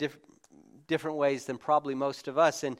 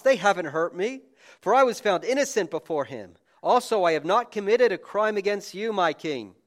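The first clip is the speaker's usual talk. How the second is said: raised, loud preaching of the king and Daniel; dramatic scripture reading; 6 semitones higher, 8 dB louder than usual. Acted. Preacher reads Daniel's reply to the king, booming. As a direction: proclaim the story to the hall.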